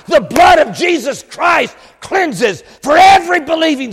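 high, shouted revival preaching; full-force preaching. Real, not acted. Preacher rejoicing that no sin withstands the blood, full-force preaching. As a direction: shout the good news to the whole congregation.

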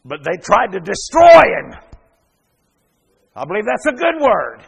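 full-force preaching. Acted. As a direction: drive it home from the pulpit, building. Preaching surge.

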